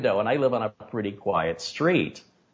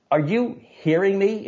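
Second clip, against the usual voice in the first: high, pressing question; exasperated demand; 11 semitones higher than usual. Retold or imitated. To the officer, an exasperated high demand. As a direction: push him hard to listen. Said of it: "I said".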